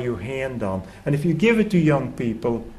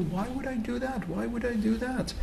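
higher, softer lazy fisherman voice; fisherman shrugging off advice; 10 semitones higher, 8 dB quieter than usual. Retold or imitated. Fisherman answering the German tourist, higher and softer, unbothered. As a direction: toss it off like a man in no hurry.

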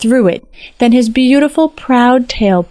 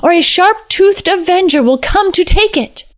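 higher, urgent threat; dire warning; 9 semitones higher than usual. A narrator's reading. Shako threatens the king with an avenger, dire warning. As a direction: warn with rising menace.

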